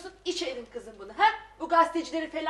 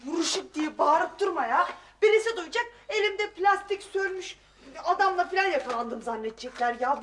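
ordinary talk

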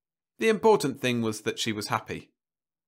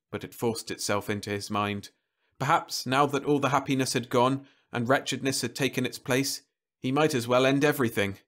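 usual speaking voice

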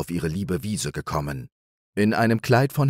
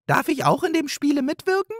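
much higher, bright magpie exclamation; delighted magpie cry; faster than usual. Acted. Magpie thrilled about the play, crying out. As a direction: squeal with delight. Magpie begs to take part.

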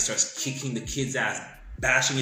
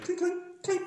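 clinking noise. Retold or imitated. Imitating gold bars clinking.

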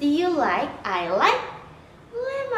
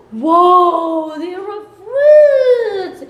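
big drawn-out whoa, much louder and higher; amazed shout; 5 semitones higher, 10 dB louder, much slower than usual. Acted. Teacher shows the kids the fruit basket, amazed loud shout. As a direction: gasp it out like a wonder for kids.

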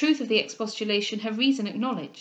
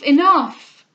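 enough cried even louder, breaking; louder plea; 5 semitones higher, 13 dB louder than usual. Acted. Cecilia begging Mrs Delvile to stop, louder still. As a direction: push the plea to its peak. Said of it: "said Cecilia".

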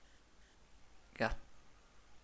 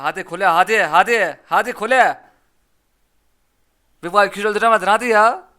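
loud, higher cheering on; loud urging; 5 semitones higher, 12 dB louder than usual. Real, not acted. Streamer pushing his card at the tower, loud pleading cheer. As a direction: yell at the tower to hurry.

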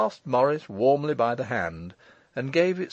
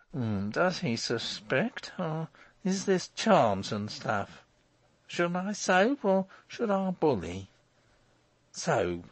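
slow, higher inner voice; scheming Morris; 5 semitones higher, slower than usual. Acted. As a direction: ponder it aloud, weighing each option.

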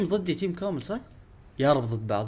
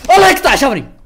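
higher, louder curse at being spotted; frustrated outburst; 9 semitones higher, 10 dB louder than usual. Real, not acted. Gamer realising the enemy saw him, loud curse. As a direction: blurt it out in alarm.

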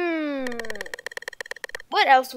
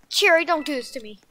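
ordinary talk

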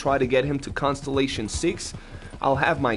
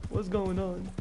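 much higher, incredulous complaint about Arthur; mock outrage; 6 semitones higher than usual. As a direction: whine in disbelief at the ending. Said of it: "I was like".

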